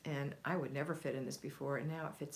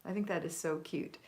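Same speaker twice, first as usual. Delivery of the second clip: normal talking voice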